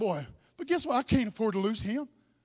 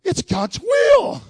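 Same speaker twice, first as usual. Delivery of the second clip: shout; 5 semitones higher, 7 dB louder, much slower than usual. Real, not acted. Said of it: "preacher proclaiming".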